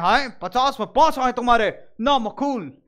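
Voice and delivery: mock stern dad telling off his child; hammed-up fatherly scolding; father's scolding. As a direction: act out an indignant father's rebuke. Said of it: "तुमको डांट देते हैं".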